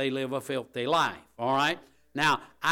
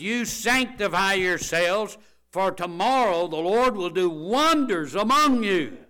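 high, loud commanding voice, slow at first; demanding command; 8 semitones higher, 10 dB louder than usual. Retold or imitated. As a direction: thunder it as Joshua's order. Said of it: "He demanded".

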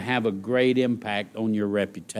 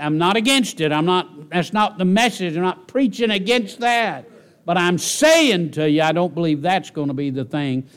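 loud, high, insistent pulpit cry; preaching at full force; 6 semitones higher, 5 dB louder than usual. Acted. Preacher insisting to his listeners he's not against fitness, loud. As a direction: drive it home from the pulpit.

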